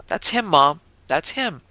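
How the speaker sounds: eager child calling out, lightly voiced; excited exclaiming. A narrator's reading. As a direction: point and insist, a little excited. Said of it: "the boy exclaimed".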